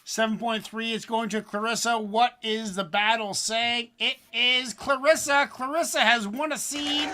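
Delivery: high, loud hyped announcer call; winner announcement. Acted. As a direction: proclaim the winner like a ring announcer.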